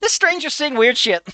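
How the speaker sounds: put-on high, eager little-kid voice; five-year-old boy. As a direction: play a cheeky five-year-old asking his mom. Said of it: "[name], you're five years old".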